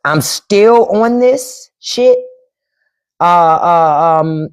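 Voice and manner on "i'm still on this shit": slow drawn-out. Real, not acted.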